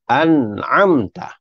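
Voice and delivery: an'amta slow, nun closed; correct an'amta. Shown as a demonstration. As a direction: model it slowly. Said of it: "hendaklah dibaca". (an'amta perlahan, nun mati)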